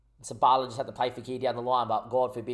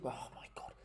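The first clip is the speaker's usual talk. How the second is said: breathy disbelieving whisper; hushed, exasperated aside; 17 dB quieter than usual. Real, not acted. Disbelief at missed pass.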